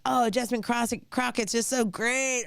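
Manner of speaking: high, airy mock gush of the friend; lightly voiced gushing friend. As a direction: parody her gushing praise. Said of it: "And she was like".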